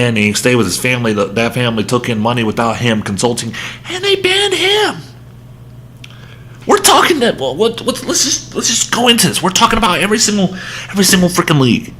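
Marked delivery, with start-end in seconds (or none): 3.9-11.9 exasperated high pitch. Real, not acted. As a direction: voice jumps way up, fast and exasperated. Rant pitch jumps way up.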